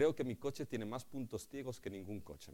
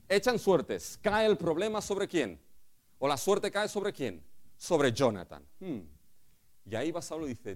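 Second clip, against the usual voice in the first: usual speaking voice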